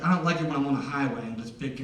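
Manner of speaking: ordinary talk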